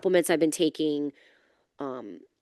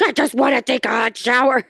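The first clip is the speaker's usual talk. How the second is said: whiny wish; 7 semitones higher, 4 dB louder than usual. Retold or imitated. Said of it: "I was like".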